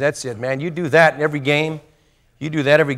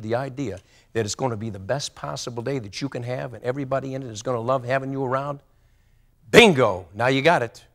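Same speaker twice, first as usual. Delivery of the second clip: ordinary talk, nothing marked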